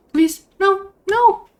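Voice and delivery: high, loud pleading no; panicked plea. Real, not acted. Gamer begging as her bird falls, panicked. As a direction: beg it not to happen, voice jumping up. Bird falling in obby game.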